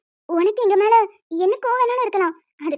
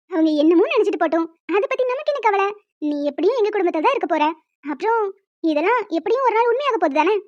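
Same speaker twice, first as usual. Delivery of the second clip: normal talking voice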